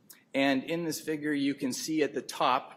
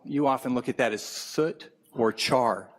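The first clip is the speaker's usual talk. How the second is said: usual speaking voice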